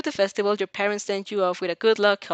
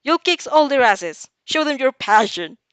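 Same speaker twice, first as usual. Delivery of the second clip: loud hearty cheering shout; rally her with a big bellow; 4 semitones higher, 7 dB louder than usual. Acted. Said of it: "he bellows".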